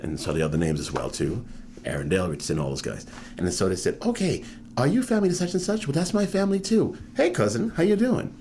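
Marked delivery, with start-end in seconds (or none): 4.0-8.3 much higher, delighted cousin greeting; light up as if you just found family; excited recognition. Retold or imitated. Relatives in Aruba greeting a cousin, delighted.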